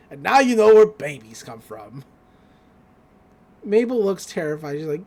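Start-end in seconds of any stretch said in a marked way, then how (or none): 0.1-2.0 put-on low, loud old-man voice; lightly hammed-up gruff uncle, pitched down; Grunkle Stan. Uncle wraps up the talk, low and emphatic. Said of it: "Grunkle Stan closing the".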